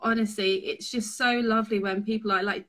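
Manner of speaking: usual speaking voice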